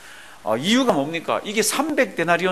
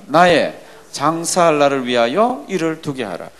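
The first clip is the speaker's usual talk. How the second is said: slow, measured Bible recitation; scripture reading aloud; 5 dB louder, slower than usual. Acted. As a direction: read the verse out slowly and deliberately. Reads verse aloud. (느리게 또박또박 성경 봉독)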